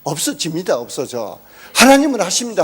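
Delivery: fervent shouted declaration; preaching declaration. Real, not acted. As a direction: proclaim it to the congregation.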